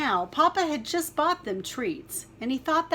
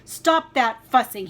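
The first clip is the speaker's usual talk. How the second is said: much louder stern fatherly command; loud Papa Bear voice; 2 semitones higher, 9 dB louder than usual. Acted. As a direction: bark it like an annoyed father bear. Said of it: "in his loudest Papa Bear voice".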